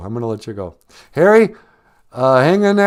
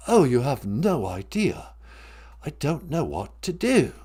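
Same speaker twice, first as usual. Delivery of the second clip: Harry; 7 semitones lower, 8 dB quieter than usual. Acted.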